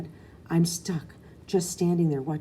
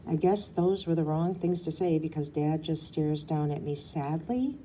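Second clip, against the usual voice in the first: ordinary delivery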